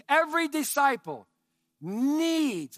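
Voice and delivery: needs hit loud and high; every disciple needs, driven home loud; stress on needs. Real, not acted. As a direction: punch the word needs. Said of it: "I can't emphasize that word enough".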